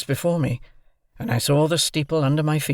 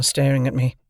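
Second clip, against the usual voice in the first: ordinary delivery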